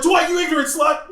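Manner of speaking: high, loud laughing yell; yelled insult. Retold or imitated. Yelling at a guy dressed as Dwight, laughing shout. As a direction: shout it out the car window. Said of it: "I was like".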